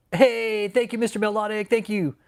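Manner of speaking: excited thanks. Real, not acted. Greeting a chat donor.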